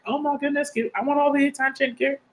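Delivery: high, soft begging cat voice. Retold or imitated. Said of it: "they're like".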